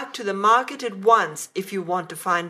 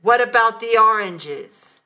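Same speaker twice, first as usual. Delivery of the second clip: a nasty, louder demand; 4 semitones higher, 7 dB louder than usual. A narrator's reading. Husband with mouth full, demanding nastily. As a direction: sneer the question at her. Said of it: "said in a nasty tone of voice".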